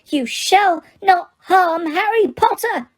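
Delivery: high, slow squeaky elf cry; Dobby shouting. Acted. Dobby defends Harry against Mr Malfoy, high slow elf shout. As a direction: plead it out as a tiny fierce house-elf. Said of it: "Dobby shouted".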